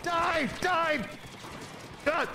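high yelled Die, full of glee; shrieking attack cry at the enemy; gleeful battle cry. Real, not acted. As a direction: yell it like the winning blow.

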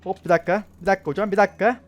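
yelling at an attacker to wait; alarmed shout. Real, not acted. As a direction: beg for a moment. Player attacking him.

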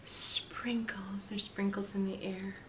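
soft hushed whisper. Acted. Reader describing the sprinkles to the children, whispered. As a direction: hush the room, wonder in it. Reading the crash scene softly.

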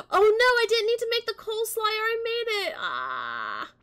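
high, loud wail of dismay; gasping, high-pitched "oh no" panic; dismayed realisation. Real, not acted. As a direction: cry out at your own blunder. Realises needless mistake in game.

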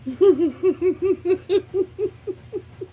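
high bright burst of laughter; giggling with joy at a gift; delighted laugh. Real, not acted. Delighted by friend's card.

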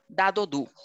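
"Dado du" with a hard D; plain D sound. Shown as a demonstration. Teacher shows D before A, O, U, hard D. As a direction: keep each D in "Dado du" plain, no J.

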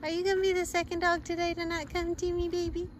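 high, soft coaxing call to a scared dog. Real, not acted. Woman coaxing scared stray dog in parking lot, high soft call. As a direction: gently lure the stray dog closer.

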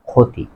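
ক্ষতি said "khoti"; teacher's model "khoti" for ক্ষতি; o after kh. Shown as a demonstration. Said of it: "এই বর্ণের সাথে ওকার হয়েছে".

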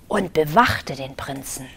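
hushed, breathy whispered narration. A narrator's reading. Narrator whispering to the children about the dragon. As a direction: lean in and whisper it like a secret.

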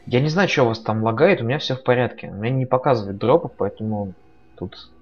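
ordinary talk, nothing marked

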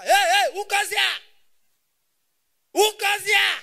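loud high challenge from a night guard; guard's shout. Retold or imitated. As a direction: yell it like a startled watchman. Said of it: "they shout".

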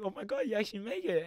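high-pitched imitation of delighted disbelief; overjoyed mom. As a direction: squeal it like a thrilled mother. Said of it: "she got so happy".

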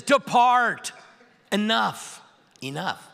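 Depart hit high and loud, then Enough twice dropping off; Jesus firmly sending Paul away, commanding; commanding order. Acted. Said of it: "And Jesus says".

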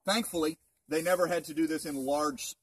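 usual speaking voice

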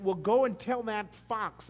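slow, firm quote of Jesus; voicing Jesus. Pastor quoting Jesus's reply to Herod, firm and slow. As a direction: set up the line before hitting it.

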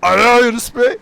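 very high, loud yell of his name; desperate shout. Acted. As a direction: scream for help down the line. (telefona umutsuzca bağırarak)

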